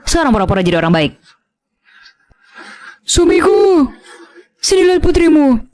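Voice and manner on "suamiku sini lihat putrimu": high, slow whimpering complaint; fake crying wife. Stepmother fake-crying to husband about Dina, whimpering. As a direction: play the wounded victim to your husband.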